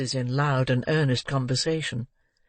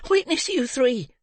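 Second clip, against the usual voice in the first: put-on boy crying out; 11 semitones higher than usual. A narrator's reading. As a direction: call the three men to witness. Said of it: "cried the boy, shaking his clenched fist".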